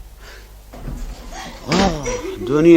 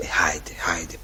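softer whisper, brushing her off; whispered hush; 4 semitones lower, 11 dB quieter than usual. Acted. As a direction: hush her under his breath. Iașa hushes Duniașa. (șoptit, ca s-o potolească)

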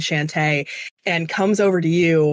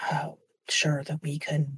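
whisper; 2 semitones lower, 8 dB quieter than usual. Acted. Father hints at a quiet deal.

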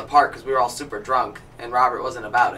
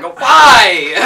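loud, higher-pitched "why" in disbelief; mock outcry; 7 semitones higher, 11 dB louder than usual. Friend recalling an odd sight, mock outcry. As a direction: cry out in comic dismay.

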